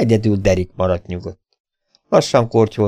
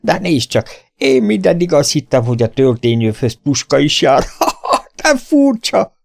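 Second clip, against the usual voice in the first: higher, laughing taunt; mocking laugh; 6 semitones higher, 3 dB louder than usual. Scarred little man laughs at the stranger, higher mocking laugh. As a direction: cackle at the greenhorn's gunbelt.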